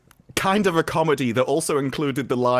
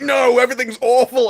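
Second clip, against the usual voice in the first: higher, louder whiny despair; mock melodrama; 9 semitones higher, 7 dB louder than usual. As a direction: ham up the gloom. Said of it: "you're like".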